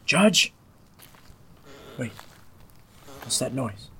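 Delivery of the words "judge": calling out. Acted. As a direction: shout out, searching. Calling for missing judge.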